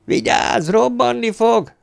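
high, drawn-out warning cry; shouted warning. A narrator's reading. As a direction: call out danger through a door. Warning shouted through the door. (magas hangon, elnyújtva figyelmeztetve)

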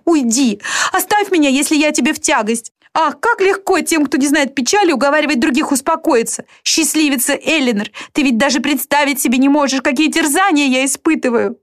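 put-on high, anguished young sister; distraught girl lashing out at her sister; Marianne voiced. (высокий, страдающий голос Марианны)

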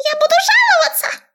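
tiny rat voice, very high and loud; squealing rat. A narrator's reading. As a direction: shriek a threat like a cartoon rat. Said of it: "взвизгнул эксперимент".

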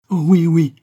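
high, loud desperate cry; frantic, pleading cry; desperate plea. A narrator's reading. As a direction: grab at hope, pleading hard. Doomed man begs doctor.

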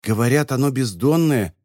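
ordinary delivery, nothing marked

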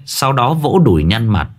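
plain reading voice

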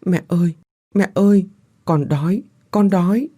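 put-on high, choked child voice; ghost child sobbing. Starving ghost child sobbing to Phương, high and choked. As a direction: whimper for mother. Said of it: "nó khóc trong nghẹn ngào".

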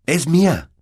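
put-on high, loud boy's protest; boy enraged over his letter; angry. A narrator's reading. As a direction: claim it back angrily. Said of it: "dijo Harry con rabia".

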